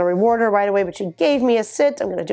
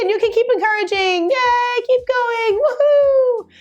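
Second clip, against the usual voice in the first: very high, excited puppy call; squeaky praising a dog; slower than usual. Real, not acted. Calling puppy, encouraging.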